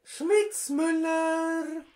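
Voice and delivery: höhere, gespielte Politikerinnenstimme; Frauenstimme. Acted. Prank call as AfD woman. (verstellte Frauenstimme am Telefon)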